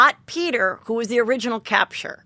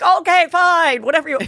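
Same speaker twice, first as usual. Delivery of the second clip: high, loud, exasperated surrender; mock giving in; 6 semitones higher, 5 dB louder than usual. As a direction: throw up a loud mock confession. Imagined exasperated confession.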